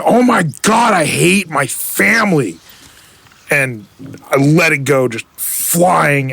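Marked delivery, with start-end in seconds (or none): 0.0-2.6 exasperated outburst. Retold or imitated. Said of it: "I'm just going".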